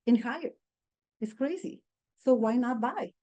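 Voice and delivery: ordinary delivery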